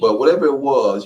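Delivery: normal talking voice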